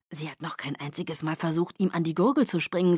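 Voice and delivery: ordinary delivery, nothing marked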